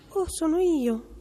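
high, small, trembling little voice; timid little goat. A narrator's reading. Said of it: "con una vocina timida e tremolante". (vocina acuta, timida e tremolante)

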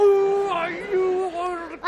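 hammed-up cry of pain, high and loud; pained yelp. Adult poked in the eye by the child, hammed-up pained yelp.

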